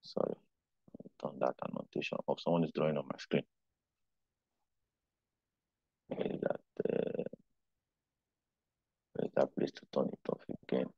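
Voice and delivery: very soft, low talking to oneself; hushed, preoccupied aside with long pauses; distracted muttering. Real, not acted. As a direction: murmur while hunting for a setting. Troubleshooting screen annotation mid-lesson.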